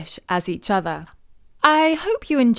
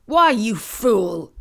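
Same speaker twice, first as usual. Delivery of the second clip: slow loud scolding cry; 5 dB louder, slower than usual. A narrator's reading. Farmer scolding his wife, slow loud cry. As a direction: scold her like a fool. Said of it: "cried the farmer".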